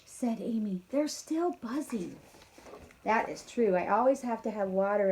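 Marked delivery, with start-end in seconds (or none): 0.0-2.1 lively storybook read-aloud; reading to children. A narrator's reading. Teacher reading a farm story to kindergarteners, lively. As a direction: read it brightly to a class of kids. Reads picture book aloud.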